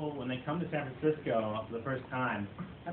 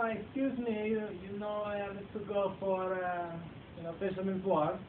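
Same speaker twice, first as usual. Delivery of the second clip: higher, badly faked Italian accent; horrible Italian accent; 6 semitones higher than usual. Italian tourist asking the way to Fisherman's Wharf, horrible accent. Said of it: "I don't know, horrible accent".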